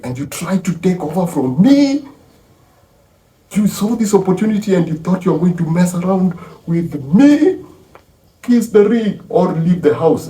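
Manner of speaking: high, raging matriarch rant, hammering the key words; acting out a furious boss putting rebels in their place; defiant tirade. Mother rages at sons who tried to overthrow her, high furious tirade. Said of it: "So she goes like that on them".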